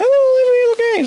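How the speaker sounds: high-pitched, mimicked announcement; high mock greeting. Reviewer mimics a character greeting everybody, high voice.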